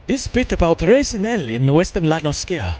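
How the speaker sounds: put-on mild English accent. Streamer reading a game letter in an English accent. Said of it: "that's why I was doing an English accent".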